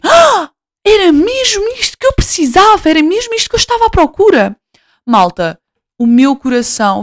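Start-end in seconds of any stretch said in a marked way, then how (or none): 0.0-4.6 much higher, delighted shoppers' cry; loud happy exclamation. Retold or imitated. Lady in shop finds item, higher delighted exclamation. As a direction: exclaim it high like a thrilled stranger. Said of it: "ela exclamou bem alto assim".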